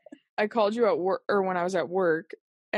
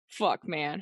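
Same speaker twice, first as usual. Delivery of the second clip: low glum; 5 semitones lower, 6 dB quieter than usual. Retold or imitated. Retold gloomy text.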